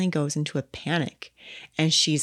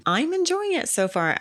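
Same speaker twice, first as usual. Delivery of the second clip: much higher, sing-song; high chirpy; 12 semitones higher than usual. Real, not acted. Co-host answering about the film, high and chirpy. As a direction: lift the line up high and bright.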